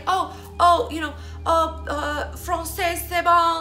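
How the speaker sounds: high, loud mock show-off; bragging French speaker. Vlogger mimics a girl bragging that she speaks French, showy. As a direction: imitate someone flaunting French, pitched right up. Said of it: "now you're fucking bragging that you speak French".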